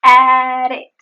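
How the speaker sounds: loud "At it" as one word, T tapped; linked flap T. Shown as a demonstration. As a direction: link "At it" with a flick. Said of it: "The flap T is just at the end".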